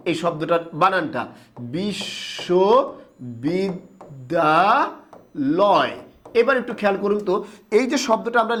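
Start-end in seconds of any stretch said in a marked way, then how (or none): 1.7-5.9 বিশ্ববিদ্যালয় drawn out very slowly, syllable by syllable; slow syllable spelling. Shown as a demonstration. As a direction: stretch বিশ্ববিদ্যালয় so each syllable stands apart. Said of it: "এই শব্দটাকে ইংলিশে লিখে ফেলেন". (বিশ্ববিদ্যালয় শব্দটা খুব ধীরে, প্রতিটি অক্ষর আলাদা করে)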